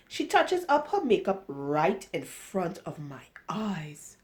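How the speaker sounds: usual speaking voice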